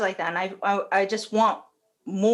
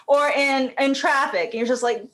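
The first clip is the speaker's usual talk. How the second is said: normal talking voice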